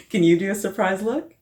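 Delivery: put-on gentle, lilting read-aloud to children; picture book read-aloud. Librarian reading a picture book to children, gentle and lilting.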